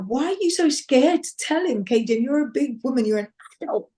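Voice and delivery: high, incredulous push; a hint of a bossy big sister. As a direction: tease her sister out of her fear. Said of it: "my sister was like".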